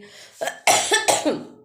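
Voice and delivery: sudden harsh cough, loud and high; real cough. Real, not acted. Storyteller coughs mid-tale, a real harsh cough. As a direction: clear the throat with a rough cough. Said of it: "थोड़ी खांसी आ रही है".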